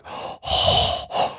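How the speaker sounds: wheezy Darth Vader breathing; heavy breathing. Pretend. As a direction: breathe like you're out of breath from the stairs. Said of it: "I'm breathing so hard".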